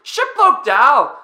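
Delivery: slow, high, loud Aussie jeer; screaming Marcus. Retold or imitated. Teammate coining a rude nickname for him, screaming. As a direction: stretch out the yelled nickname. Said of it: "screaming and yelling".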